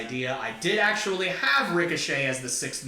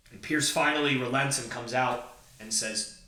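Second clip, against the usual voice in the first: normal talking voice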